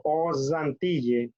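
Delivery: "Aux Antilles" linked by a buzzing z; liaison with Z. Shown as a demonstration. Teacher explains the vowel rule, pronounced with z liaison. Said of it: "Pronuncio la Z".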